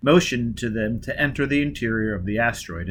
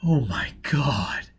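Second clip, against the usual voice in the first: very slow, hushed horror; appalled; 2 semitones higher, much slower than usual. A narrator's reading. Foyle staring at his face in the mirror, appalled. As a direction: breathe it out in shock.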